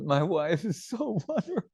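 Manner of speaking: high, hammed-up weepy voice, half laughing; mock crying husband. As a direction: play the foolish husband gushing through mock tears. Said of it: "And Abhimanyu starts crying".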